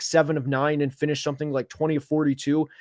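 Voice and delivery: usual speaking voice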